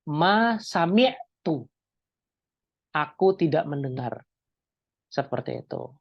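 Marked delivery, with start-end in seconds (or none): 0.1-1.6 Ma sami'tu said slowly, word by word; teacher slowly modelling an Arabic phrase; slow demo. Shown as a demonstration. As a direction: spell out Ma sami'tu slowly for students to repeat. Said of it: "teacher demonstrating Arabic phrase".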